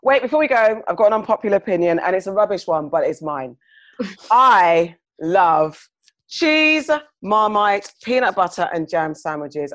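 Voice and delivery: loud, fast and high announcement; excited. Real, not acted. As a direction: burst in loudly with a confession. Bursts in with an unpopular opinion.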